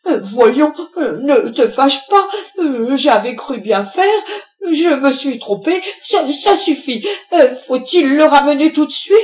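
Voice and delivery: high, loud, stammering apology; flustered placating. A narrator's reading. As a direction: backpedal hastily with nervous hesitations. Cowed husband placates his furious wife.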